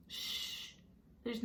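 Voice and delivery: hushing whisper that stays soft; a mother's near-silent hush; barely audible. A narrator's reading. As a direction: shush him gently, almost no voice. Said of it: "so quietly I could barely hear her".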